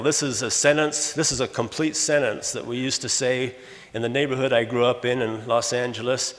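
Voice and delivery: ordinary delivery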